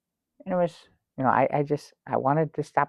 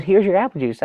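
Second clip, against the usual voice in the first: flight attendant; 7 semitones higher, 4 dB louder, faster than usual. Retold or imitated. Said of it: "She goes".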